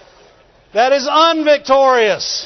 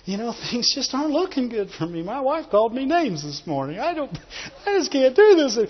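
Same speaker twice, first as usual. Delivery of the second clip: much softer, discouraged mumbling to a brother; hushed, mock-defeated moaning; defeated complainer; 8 dB quieter than usual. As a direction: grumble it quietly, full of woe. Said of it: "They don't talk".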